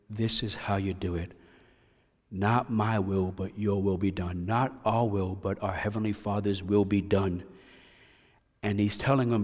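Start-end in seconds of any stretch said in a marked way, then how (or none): 0.1-1.3 calm teaching. Acted. Jesus teaching disciples on an ordinary day, calm and soft. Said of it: "in an everyday life as usual moment". 2.3-3.3 calm everyday surrender. Acted. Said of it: "in an everyday life as usual moment". 3.3-7.4 calm teaching. Acted. Jesus teaching disciples on an ordinary day, calm and soft. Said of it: "in an everyday life as usual moment".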